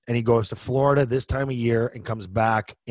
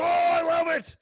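much higher squeal of delight; delighted old man. Retold or imitated. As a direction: squeal it like a thrilled old-timer. Old man's delighted reaction.

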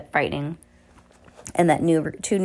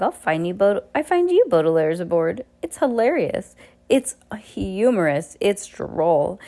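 usual speaking voice

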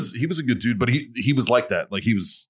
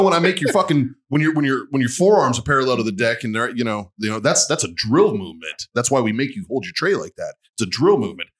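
ordinary talk, nothing marked

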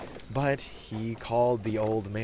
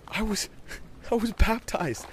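put-on high kid voice, bursting with pride; breaking off excitedly, then proudly telling; 14 semitones higher, 3 dB louder than usual. A narrator's reading. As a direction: announce big news as a proud boy. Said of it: "Bobby said proudly".

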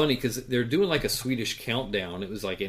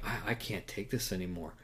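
softer, worn-out giving up; quiet exasperation; 2 semitones lower, 9 dB quieter than usual. Retold or imitated. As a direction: mutter it like you're done. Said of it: "And I'm like".

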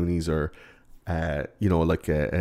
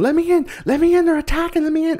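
very high, louder frantic begging; mock desperate plea; 4 dB louder, faster than usual. Horace begging the bullies to open, hammed-up high panic. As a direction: squeal at a locked door in terror.

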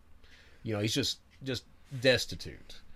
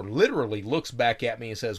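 ordinary talk

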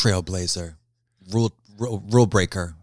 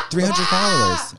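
excited shout; 7 semitones higher than usual. Real, not acted. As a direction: shout it out in disbelief. Excited about tiny follower count.

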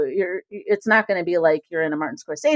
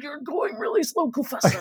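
higher, softer, strained mock zombie moan; go faster voice; 6 semitones higher, 7 dB quieter than usual. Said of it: "that's my go faster voice".

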